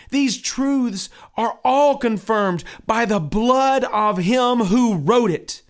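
high, loud pleading pilgrim. Acted.

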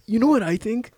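high-pitched mimicry of a hustle guru; Gary V impression. Podcaster mocking a social media mogul to his co-host, exaggerated impression. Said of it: "That's how he talks".

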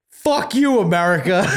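high blustering TV pundit yell; Bill O'Reilly impression. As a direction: bark it like an outraged pundit. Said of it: "greatest Bill O'Reilly impression ever".

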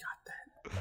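breathy whispered echo; whispering. Retold or imitated. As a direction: whisper the line back. Echoing the movie line.

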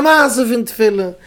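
high, drawn-out announcement; soaring, stretched-out exclamation; triumphant exclamation. Retold or imitated. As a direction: proclaim it high and slow. Exclaims the point of his anecdote.

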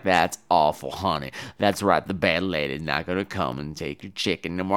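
put-on husband soothes her like a child, low and soft; soothing husband. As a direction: pat her on the head with your voice. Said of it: "He's like, ... calmed me down".

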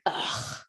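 audible, breathy "ugh" of annoyance; heavy sigh on a voiceless ugh; imitated exasperated sigh. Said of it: "the waitress had an audible sigh".

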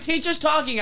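high, mock-indignant call; mock classroom hushing. Pupil hushing class because teacher's talking, mock urgent.

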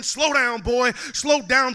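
loud, slow shouted command; yelling slow down, drawn out; mock yell. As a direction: bellow it like the crowd yelling. Crowd yelling at the poet.

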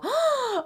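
high, hammed-up gasp; shocked gasp. Host playing the other person's imagined response, shocked gasp. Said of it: "And then they go".

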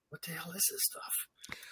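unvoiced whisper to himself; whispered thought. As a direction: breathe the thought out, barely audible. Inner thought about strange product.

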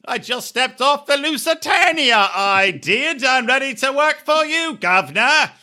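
put-on English accent. Podcaster mimicking a disguised castmate, fake English accent. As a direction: play a newly arrived Englishman with a silly English accent. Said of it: "speak in an English accent".

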